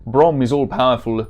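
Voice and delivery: put-on German-accented warrior; hammed-up German accent. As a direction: play it as an earnest German hero. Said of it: "supposed to be a German accent".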